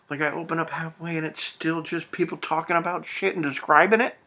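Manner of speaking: high-pitched exasperated complaint. Real, not acted. Fed-up reader griping about a book with no sex yet, exasperated.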